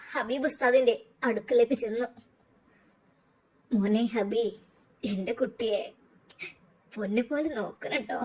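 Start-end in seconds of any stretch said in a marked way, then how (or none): 3.7-8.2 softer, a touch of tears in the voice; a father quietly begging through mild tears; tearful plea. Acted. Father to his new son-in-law, softly and mildly tearful. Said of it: "ആ ഉപ്പ കരഞ്ഞുകൊണ്ടാണ് അത് പറഞ്ഞത്".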